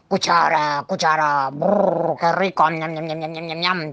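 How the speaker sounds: gleeful munching monster; delighted monster. Acted. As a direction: relish each word, with rolled brrr and chomps. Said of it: "decía el monstruo encantado".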